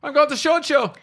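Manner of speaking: very high, hammed-up German trooper; comic trooper threatening to shoot. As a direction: squeal the threat like a cartoon trooper. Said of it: "So I'll play the German troopers".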